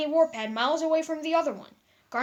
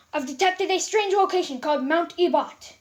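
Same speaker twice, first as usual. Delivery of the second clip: firm statement; 4 semitones higher, 6 dB louder than usual. A narrator's reading. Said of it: "Garnet stated".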